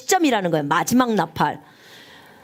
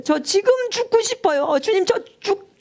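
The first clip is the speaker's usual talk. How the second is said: much higher, tearful begging; crying prayer; 7 semitones higher than usual. Retold or imitated. Preacher retelling begging God to let her die, crying. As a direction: sob the plea upward. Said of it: "막 울면서 기도를 했어요".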